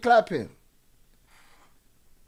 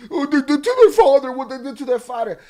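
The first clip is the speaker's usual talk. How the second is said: higher, louder sneering mock of the comments; mocking commenters; 6 semitones higher, 9 dB louder than usual. Streamer mocking viewers' comments about the father, sneering mock voice. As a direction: parrot the commenters with a nasty sneer.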